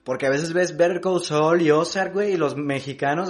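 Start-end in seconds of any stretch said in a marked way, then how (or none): none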